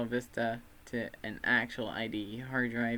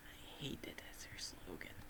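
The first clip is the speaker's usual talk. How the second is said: whisper; 5 semitones lower, 16 dB quieter than usual. Real, not acted. Whispered aside.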